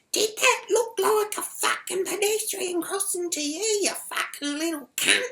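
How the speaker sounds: very high screechy mock rant; ballistic abuse. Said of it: "the shit coming out of her mouth, she's like".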